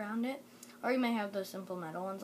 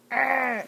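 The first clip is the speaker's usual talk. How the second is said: frustrated growl; 5 semitones higher, 14 dB louder than usual. Real, not acted. Frustrated while searching for chapstick.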